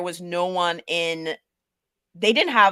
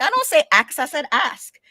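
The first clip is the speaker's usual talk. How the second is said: high, louder protest at being corrected; indignant retort; 8 semitones higher, 5 dB louder than usual. Retold or imitated. Speaker retells answering her teammates' correction, indignant and high-pitched. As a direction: insist it in a raised, high voice.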